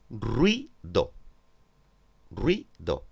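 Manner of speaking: "ruido" in two separate beats, "rui" then "do"; "ruido" slowly divided as "rui-do"; split into syllables. Shown as a demonstration. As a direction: say "ruido" syllable by syllable, keeping "ui" together. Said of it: "Dos sílabas".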